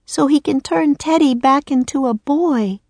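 put-on high, earnest girl's voice; high-voiced young heroine appealing; Annie pleading. A narrator's reading. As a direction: plead with a friend, pitched high. Annie urging Rock.

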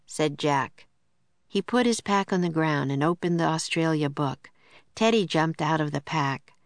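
ordinary delivery, nothing marked

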